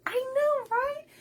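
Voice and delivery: high, eager I know right; excited agreement. Real, not acted. Host agreeing with a chat comment, excitedly.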